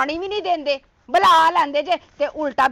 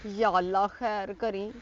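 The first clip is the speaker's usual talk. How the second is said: quiet prayer; 9 semitones lower, 11 dB quieter, slower than usual. Acted. Praying quietly to herself.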